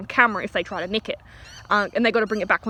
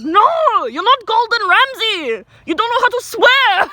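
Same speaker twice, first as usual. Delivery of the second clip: loud, high mock rant in an Indian accent; hammed-up Indian-accented outburst, drawn out; bad Indian accent; 11 semitones higher, 7 dB louder, slower than usual. Boss rebukes the would-be cook, ranting in a mock Indian accent. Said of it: "horrible Indian accent".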